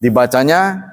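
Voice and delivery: ordinary delivery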